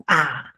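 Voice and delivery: AH begun with a popped ejective t; explosive t. Shown as a demonstration. Teacher showing the explosion, explosive AH. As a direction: burst the tongue off the upper teeth into AH. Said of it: "explosión".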